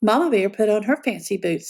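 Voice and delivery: ordinary delivery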